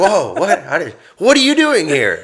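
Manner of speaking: high, loud startled whoa; shocked German couple. Retold or imitated. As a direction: mimic a couple caught off guard. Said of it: "they were like".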